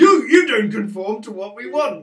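loud, hammed-up indignant shout; a loud parody of righteous indignation; mock outrage. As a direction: play it as a pompous officer scandalised by rule-breaking. Mocking Vulcans as bigoted officials.

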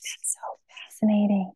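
hushed whisper of fascination; awed whisper. Retold or imitated. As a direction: breathe it out in wonder. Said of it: "And I'm like".

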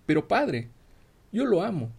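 pleading exclamation. Acted. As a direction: plead with your father, voice lifting. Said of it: "exclamaba la doncella".

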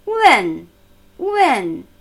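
"Wen" loud and falling, ending on n; front-tongue N. Shown as a demonstration. Teacher showing the final consonant, "Wen" ending on front n. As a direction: close "Wen" with the tongue behind the upper teeth. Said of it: "das nächste N".

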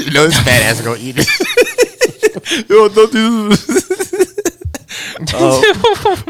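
high, drawn-out words through real laughter; cracking up. Real, not acted. Friend joking about sneaky shrooms on pizza, cracking up. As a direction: laugh helplessly while protesting, voice shooting up.

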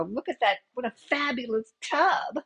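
a touch of star-struck film groupie. Acted. Groupie admiring rock star's bathroom, lightly hammed-up delight. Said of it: "she goes into the bathroom".

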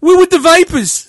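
lightly voiced mock mob boast; Sopranos gangster. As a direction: ham it up like a Sopranos tough guy. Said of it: "it's like Sopranos".

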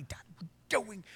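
soft, half-voiced disbelief; hushed warning to someone across the room; imitated mouthing. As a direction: act out a discreet, urgent reproach. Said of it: "he's mouthing at me".

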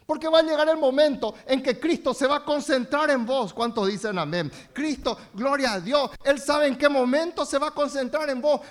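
loud, driving sermon voice; fervent preaching. Acted. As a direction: push the message hard at the congregation. Preaching to the congregation.

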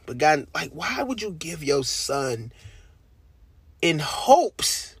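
high, slow, wondering why; emotional pleading. Real, not acted. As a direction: ask God why in overwhelmed awe. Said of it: "pleading why to God".

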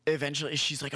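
normal talking voice

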